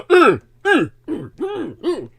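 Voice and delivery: imitating grunting. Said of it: "basically grunting".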